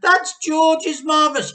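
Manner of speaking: piercing very high, very loud cry; a desperate shriek; Missus Cranky crying out. A narrator's reading. Missus Cranky cries out to stop Grandma. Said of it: "cried Missus Cranky".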